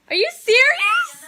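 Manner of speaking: high, loud are you serious; disbelief. Real, not acted. Hearing the surprise was spoiled, squealing in disbelief.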